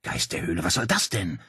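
leiser, ungläubiger Einwurf; verständnisloses Nachfragen. A narrator's reading. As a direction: den Unsinn gedämpft hinterfragen. Claudia tippt sich an die Stirn. (verständnislos fragend)

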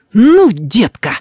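put-on high, drawn-out childish threat; threatening little boy. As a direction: warn grandpa slowly in a boy's voice. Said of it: "сказал Рис".

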